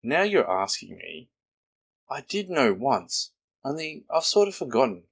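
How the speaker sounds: put-on a child's careless shrug of an answer; a hint of a small boy, breezy; boy Christopher Robin.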